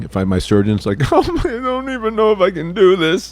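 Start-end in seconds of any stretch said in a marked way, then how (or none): 1.1-3.3 high, tearful whine; hammed-up weepy self-doubt; mock crying surgeon. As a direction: wail like a surgeon losing their nerve. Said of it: "my surgeon crying".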